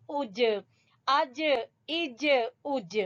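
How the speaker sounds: each اج ending in a bounced j; qalqalah. Shown as a demonstration. Teacher drilling the three syllables, qalqalah bounce on اج. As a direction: release every j in اج with a short echo. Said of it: "حروف قلقلہ میں سے۔".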